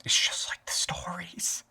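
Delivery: slow, awed whisper; hushed onlookers' whisper. Acted. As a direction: whisper it slowly, like a rumor passing through a crowd. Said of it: "People are going to whisper".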